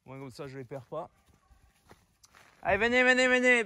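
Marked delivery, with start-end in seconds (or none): 2.6-3.6 high, loud coaxing call; calling the puppies. Real, not acted. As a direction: coax the pups along.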